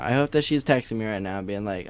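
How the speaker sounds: ordinary talk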